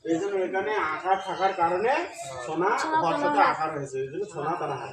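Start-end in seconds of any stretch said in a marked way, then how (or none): none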